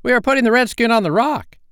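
very high, loud pirate holler; shrieked report to the captain; called out. A narrator's reading. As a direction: shout it across the water. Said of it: "Smee called out".

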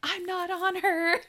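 high, loud mock wail; yelled protest. Re-enacting John's reply to Ellie, yelled protest. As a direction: protest in hammed-up disbelief.

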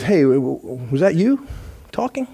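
a nervous burglar stuttering into the dark; startled thief. Retold or imitated. Startled burglar stammers at parrot.

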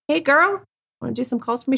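high-pitched friendly come-on; breezy pitch to lure a girl; recruiting pimp voice. Retold or imitated. Pimp recruiting girls, retold.

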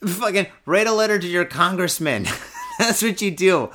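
fast, laughing through the words. Real, not acted. Ranting vlogger laughing at his own advice, laughing. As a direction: break into laughter as you speak.

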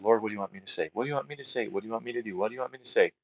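hushed, soft repeated plea to God; quiet pleading prayer. Retold or imitated. Praying alone on the way to the camp, hushed and pleading. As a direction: ask the Lord softly, almost to yourself.

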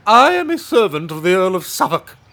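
high, loud pompous declaration; haughty servant. Acted. Servant of the Earl dismisses robbers, loud and pompous. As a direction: puff up with importance.

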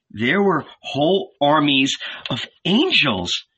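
high, swooping, marvelling voice; awestruck wonder. A narrator's reading. Salome tells Mary of the angels, awestruck.

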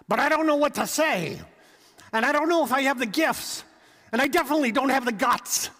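a nervous believer pleads with his Lord; anxious excuse. Acted.

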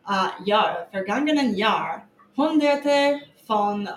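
halting, stumbling German read aloud; terrible German. Real, not acted. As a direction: sound out German word by word. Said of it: "my terrible German".

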